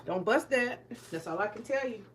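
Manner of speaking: usual speaking voice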